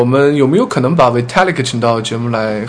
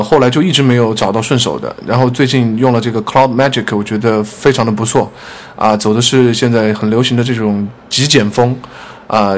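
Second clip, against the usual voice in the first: ordinary delivery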